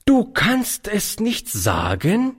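put-on slow scolding old aunt; reproachful. A narrator's reading. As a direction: rebuke him with the question. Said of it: "wiederholte sie in strafendem Ton".

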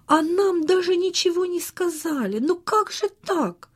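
high-pitched indignant protest; object, hurt at being left out; acted boy's indignation, pitch soaring. A narrator's reading. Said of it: "возмутился Саша".

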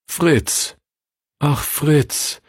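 high, pitying sigh of a name; sorrowful sigh for her brother. A narrator's reading. Sister Frieda to her shattered brother, sighing. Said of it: "seufzte Schwester Frieda".

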